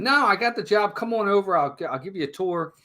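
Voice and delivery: lightly acted dad talking up the job; eager reassurance. Dad reassuring son, quoted.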